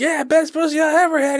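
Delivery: high, put-on bro voice; mock bragging heckler. Other dude bragging from the crowd, in a high put-on bro voice. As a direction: brag to provoke him. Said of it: "this other dude's like".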